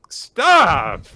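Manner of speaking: a loud, high shout of Stop!; loud protest. Real, not acted. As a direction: yell it to make the scene stop. Overwhelmed by the touching scene.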